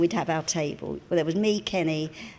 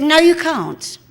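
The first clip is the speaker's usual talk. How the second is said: imitating a loud, higher, snooty no; haughty refusal; 8 semitones higher, 9 dB louder, slower than usual. Kenny refuses a fan's autograph, loud and snooty. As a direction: brush the fan off loudly. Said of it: "He said".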